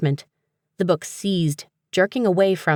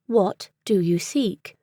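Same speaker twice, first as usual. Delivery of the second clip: slow, formal British voice; crisp British accent; 3 semitones higher, slower than usual. A narrator's reading. Book ignoring the answer, crisp British accent. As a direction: repeat it unmoved in crisp British English. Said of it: "in a crisp British accent".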